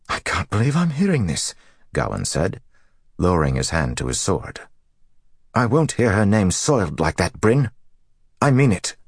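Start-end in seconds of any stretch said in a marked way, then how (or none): 0.1-1.5 high-pitched, appalled protest; indignant shock, voice lifted; angry disbelief. A narrator's reading. Rage and shock fighting. 5.5-9.0 high, heated ultimatum building louder; indignant defence of a mother's honour; angry warning. A narrator's reading. Gawain warns, hand to sword.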